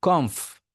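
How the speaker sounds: কমফ with a puff of air on the f; airy final f. Shown as a demonstration. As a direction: end কমফ on an audible "fff". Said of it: "বাতাসটা আসবে". (কমফ-এর শেষে বাতাস ছেড়ে)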